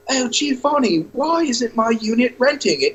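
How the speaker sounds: a fussy landlord pleading with her agent; high-pitched complaining client; whiny landlord. Retold or imitated. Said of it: "A dozen showings later".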